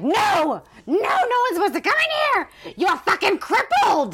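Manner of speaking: high, shrill-pitched mock scream; hammed-up outrage at being found; mock furious nurse. Nurse catching Annie in her quarters, mock screaming.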